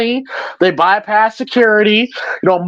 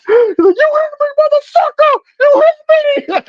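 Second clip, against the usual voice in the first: mock outraged yell. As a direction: yell it like you've been hit. Imitating Martin scaring old man.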